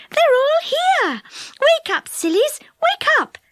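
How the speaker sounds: high, excited fairy cries; squealing. A narrator's reading. Fairy waking her sleeping friends, high excited squeals. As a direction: call out urgently in a very high voice. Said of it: "squealed Silky".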